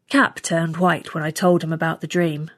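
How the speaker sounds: ordinary delivery, nothing marked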